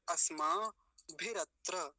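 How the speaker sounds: अस्माभिरत्र drawn out, pausing after asmaa; separated r and tra. Shown as a demonstration. Teacher showing "like this", slow separate sounds. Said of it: "ऐसे".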